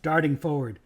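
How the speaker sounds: ordinary delivery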